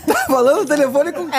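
high laughing mock husband; jealous husband.

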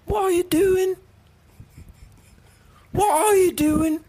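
high, hammed-up British accent; mock British grocer, voice pitched well up; British shopkeeper. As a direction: play a startled British clerk catching a thief. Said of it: "a British man that works at a grocery store".

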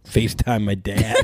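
ordinary delivery

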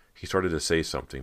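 usual speaking voice

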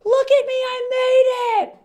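high, slow, crying shout; wild high yell, voice cracking; screaming out the window. Retold or imitated. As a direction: scream it in triumph at the old school. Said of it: "I screamed at them".

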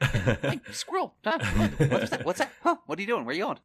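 high, loud, scatterbrained dog voice jumping at every distraction; dumb excited dog. Pretend. Imagining his talking dog chasing squirrels, high excited yelps. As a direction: play a dog who just spotted a squirrel.

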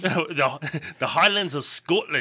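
hammed-up Scottish accent; bad Scottish accent. Introducing the new listener to co-host, bad Scottish accent. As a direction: have a clumsy go at a Scottish accent. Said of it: "probably the worst accent ever".

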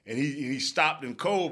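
usual speaking voice

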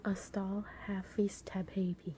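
whispering. Acted. Whispering the phrase to decode.

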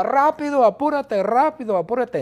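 high, fast cries of hurry; loud, urgent, high-pitched nagging; impatient shouting. Acted. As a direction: shout like a whole impatient family. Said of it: "Todo el mundo grita".

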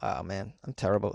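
ordinary talk, nothing marked

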